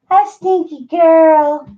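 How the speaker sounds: high drawn-out pet greeting. Real, not acted. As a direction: coo slowly at the dog. Baby talk to the dog.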